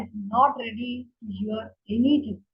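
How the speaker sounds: ordinary talk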